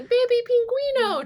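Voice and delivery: put-on high, excited cooing at a baby penguin; imitating a sketch character, high; mock SNL sketch voice. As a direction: squeal it like the sketch. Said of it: "from that S N L sketch".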